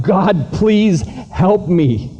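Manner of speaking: high, pleading cry to God; exhausted climber crying out to God, pleading; desperate cry. Acted. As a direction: beg for help, worn out. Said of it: "Will finally cried out".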